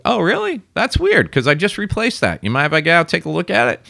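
high-pitched mock puzzlement; feigned surprise. Driver answering cop about tag light, put-on surprise. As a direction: play innocent, voice lifted high. Retold reply to a doubted cop.

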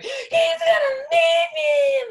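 piercingly high, loud, slow wail; screaming tantrum. Retold or imitated. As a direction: re-enact a full tantrum scream, stretching every word. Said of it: "I'm screaming like".

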